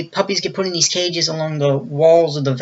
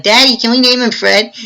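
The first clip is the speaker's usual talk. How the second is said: put-on higher, hopeful child ask; little girl voice; 7 semitones higher, 4 dB louder than usual. Little daughter asks dad, high hopeful voice. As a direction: sweetly plead as the little girl.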